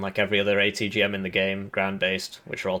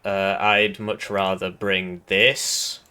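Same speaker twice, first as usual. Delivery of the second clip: ordinary talk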